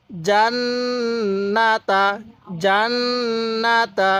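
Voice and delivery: জান্নাতা very slow, doubled n held long; drawn-out nasal chant on জান্নাতা; ghunnah recitation. Shown as a demonstration. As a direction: hum through the nn of জান্নাতা. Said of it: "মিমে নুনে তাজদিদ গুন্নাহ করা ওয়াজিব".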